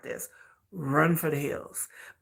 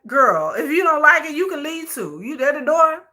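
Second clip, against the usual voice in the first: sassy; 8 semitones higher, 4 dB louder than usual. Retold or imitated. Sassy retort to idol.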